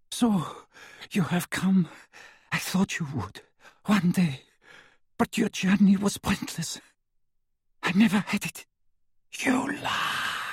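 put-on high, soft, wheezing old prisoner; a toothless old man mocking; frail old man. A narrator's reading.

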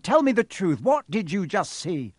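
high, loud demand; shouted. A narrator's reading. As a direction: shout for the truth. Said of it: "Quirrell shouted".

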